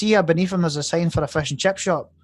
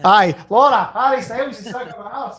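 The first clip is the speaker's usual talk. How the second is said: laughing through the headline, pitched up; 6 semitones higher than usual. Real, not acted. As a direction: read it while cracking up.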